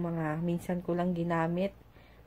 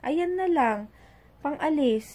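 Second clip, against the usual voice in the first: put-on child's voice; 7 semitones higher, 4 dB louder, slower than usual. Child's reply, mother retelling.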